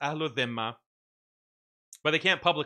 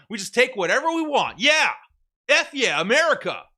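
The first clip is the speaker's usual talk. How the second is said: mock swaggering boast; 2 semitones higher, 4 dB louder than usual. Mocking Americans as loud, boastful warmongers. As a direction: bellow it like a cocky bully. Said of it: "And then they turn around".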